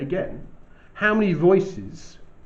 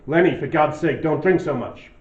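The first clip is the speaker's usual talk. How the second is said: put-on tough American accent; 7 dB louder than usual. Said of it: "quite a tough accent".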